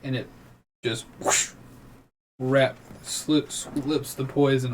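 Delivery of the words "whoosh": whispered rushing whoosh; voiceless swish of air; whoosh sound effect. Acted. Game master shows poison slipping off, breathy whoosh noise.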